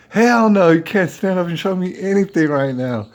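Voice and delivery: loud, high-pitched burst, easing off; emphatic refusal. Retold or imitated. To stranger asking to stand up, emphatic refusal. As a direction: fire back an outraged no.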